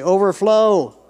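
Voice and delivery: loud, high shout of the word; ringing preacher's prompt; congregational call-out. Shown as a demonstration. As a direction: belt it out for the crowd to repeat.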